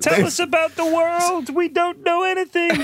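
high, slow pleading castaway voices; mock cut-off prisoners. Jokingly playing stranded islanders begging a visiting guest for news.

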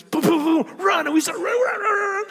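very high, loud getaway yell; squealed, loud alarm to run; frantic excited shout. Retold or imitated. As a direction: imitate a thrilled kid fleeing. Daughter knocks, both flee excitedly.

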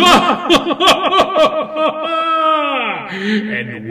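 high, loud villain laugh; evil cackle. Acted. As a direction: cackle like a vampire count. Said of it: "Count Brian Garnula".

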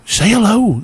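high-pitched prompt from the ego; eager ego. Acted. Ego jumps out to urge a hello, eager high voice. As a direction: pipe up high, egging them on. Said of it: "Does your ego kinda like jump out of the body and go".

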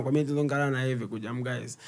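normal talking voice

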